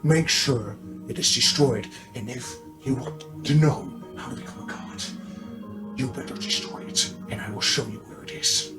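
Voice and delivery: near-whispered monster voice; hushed monster. Acted. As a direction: drop to a hushed, ominous bargain. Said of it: "hush, no, angered voice".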